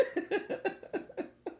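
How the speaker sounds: long, high laughing fit; helpless laughter. Real, not acted. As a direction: laugh until you can't talk. Cracking up over her attempt.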